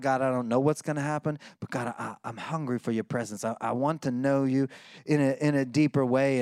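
soft, low, hungry plea; hushed yearning for God's presence; quiet, low-pitched longing prayer. Retold or imitated. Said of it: "can you hear the desire in David's voice".

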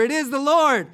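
loud, high proclamation; exclaiming. Acted. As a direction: cry out the discovery to a friend. Disciple exclaims recognizing Jesus, acted.

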